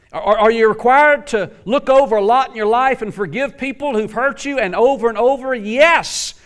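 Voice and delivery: high, loud insistent sermon; preacher hammering the question; full-force preaching. Acted. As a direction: drive the point home. Preaching climax.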